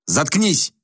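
barked. Acted. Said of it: "рявкнул Хобарт".

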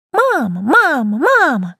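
high shrill mocking imitation; shrill mocking hag. A narrator's reading. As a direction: mimic the kitten in a screechy old hag voice. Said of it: "Её пронзительный голосок". (тонкое визгливое передразнивание)

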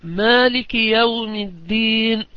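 مالك said with a long a after the m, slow and chanted; Maalik with long a. Shown as a demonstration. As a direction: draw out the alif in مالك. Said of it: "بإثبات ألف بعد الميم".

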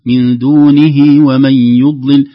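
"من دونه ومن يضلل" joined, slow and loud; careful recitation. Shown as a demonstration. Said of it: "لو وصل يقول". (وصل مرتل بصوت عال)

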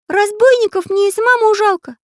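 very high, loud cartoon voice; pencil character. A narrator's reading. Artist admits he pities robbers too, high cartoon voice. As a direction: squeak it out loudly, far above normal. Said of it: "сказал Карандаш".

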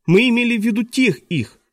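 put-on high-pitched young boy correcting an adult; boy's correction. A narrator's reading. Klaus corrects teacher about whom they meant, high insistent voice.

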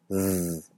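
Z as a buzzing "zzz"; voiced S. Shown as a demonstration. Teacher demonstrates a sonorized S, buzzing. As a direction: hum through the S to make z. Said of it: "se sonoriza parcial o completamente".

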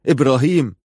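a restrained narrated shout; calling out loudly. A narrator's reading. Hussein calling to his arriving brother, a contained shout. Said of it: "وصاح بأعلى صوته".

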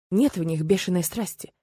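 ordinary delivery, nothing marked

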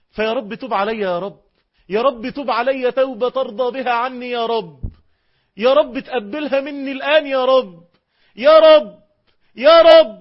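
crying out to God in full earnest; pleading cry to God. Acted. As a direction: beg harder and harder until you cry out. Prayer rising to a desperate cry.

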